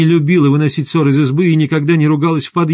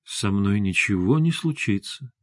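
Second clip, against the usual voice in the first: soft, lowered, soothing voice; mild, hushed husband's reply; gentle reassurance; 4 semitones lower, 7 dB quieter than usual. A narrator's reading. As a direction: reassure her gently and quietly. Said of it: "мягко сказал Максим".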